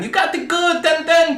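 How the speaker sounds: put-on high-pitched caricature of Chinese-accented English; mock Chinese accent. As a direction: imitate the test proctor in a squeaky Chinese accent. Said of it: "that's how she fucking sounded".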